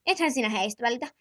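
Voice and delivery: ordinary delivery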